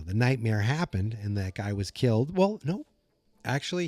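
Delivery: ordinary talk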